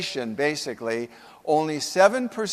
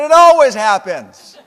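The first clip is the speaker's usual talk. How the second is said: whining complaint; 5 semitones higher, 15 dB louder than usual. Retold or imitated. Joe's resigned complaint.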